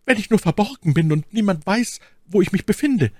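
high, pleading maid's voice; flehende Ketty. Acted. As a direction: beg him in a high, urgent voice. (flehende, hohe Mädchenstimme)

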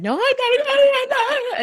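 high, loud, tearful protest; retold crying. Patient to nurse after the biopsy gun, high loud tearful denial.

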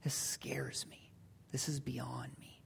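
low, whispered dread; fearful Simon. Acted. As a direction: whisper it, overwhelmed by what you face. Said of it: "His first reaction is fear".

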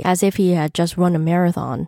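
ordinary delivery, nothing marked

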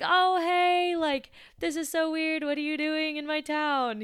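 high, loud, forced brightness; embarrassed fake cheer. Barista caught off guard by her date, forced cheerful greeting. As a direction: paper over the awkwardness with fake cheer. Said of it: "I was so embarrassed".

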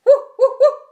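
high, loud woofs; three short, loud dog barks; dog barking. A narrator's reading. Dog answers Duck's hello, barking high. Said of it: "said Dog".

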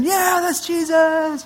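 high, slow drawn-out cheer; crowd cheering. Pretend. Crowd cheering for Jesus, excited. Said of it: "everyone starts cheering".

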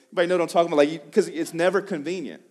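ordinary delivery

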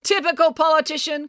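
high-pitched heckling call. A narrator's reading. April heckles the mayor from the crowd, calling out. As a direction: call it out scornfully over the crowd. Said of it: "called April".

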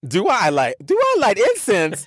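high, laughing repeat of the question; incredulous eager echo. Retold or imitated. Scared traveler answering soldier's incense question, high and incredulous. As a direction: leap up in pitch with comic disbelief.

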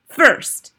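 put-on high, loud accented witch; imperious Grand High Witch. A narrator's reading. Grand High Witch beginning her recipe, imperious. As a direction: announce it sharply as the witch.

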